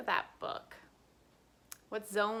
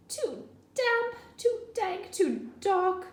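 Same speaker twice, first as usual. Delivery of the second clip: high little mouse voice; tiny high squeak of a mouse; squeaky mouse voice; 10 semitones higher than usual. Acted. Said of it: "Mouse squeaks".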